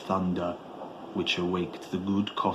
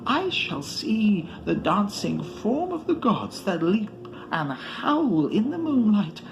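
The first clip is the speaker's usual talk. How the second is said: high, ecstatic voice of Barzai climbing, dropping to a hush at the end; shriller, rising sage; 9 semitones higher than usual. A narrator's reading. Barzai calls down to Atal about the moonlit gods, shrill and rapt. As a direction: rapt and breathless, let it climb. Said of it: "heard Barzai's voice grow shriller".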